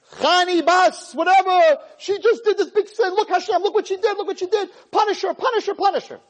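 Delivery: high-pitched, frantic accuser; Satan tattling. Acted. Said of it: "he flies up to Shemayim".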